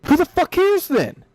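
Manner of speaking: very high, drawn-out exasperation; real exasperation, voice shooting way up; exasperated outburst. Real, not acted. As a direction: throw it out in a slow, high-pitched cry. Exasperated end of his rant.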